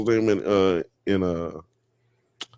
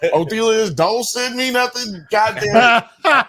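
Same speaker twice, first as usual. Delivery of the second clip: high pleading mock voice; 9 semitones higher than usual. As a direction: beg in a high strained voice. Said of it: "He's like".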